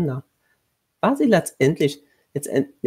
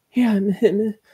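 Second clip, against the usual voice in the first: higher-pitched, mildly desperate plea; pleading; 6 semitones higher, 3 dB louder than usual. A narrator's reading. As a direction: appeal to heaven helplessly. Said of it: "flehte sie".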